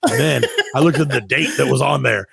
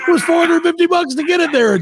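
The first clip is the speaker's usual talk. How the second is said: indignant disbelief; 13 semitones higher than usual. Real, not acted. Repeating the outrageous price.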